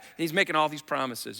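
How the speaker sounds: ordinary talk, nothing marked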